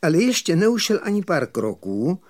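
plain reading voice

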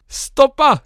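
high, loud warning yell; sudden high-pitched holler; roared shout. A narrator's reading. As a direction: yell it to clear the track. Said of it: "zařval dobře rostlý šesták".

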